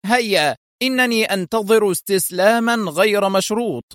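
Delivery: high, firm villain demand; a hint of a cold commander; stern demand. A narrator's reading. Foster demanding unconditional surrender, stern. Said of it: "يقول في صرامة".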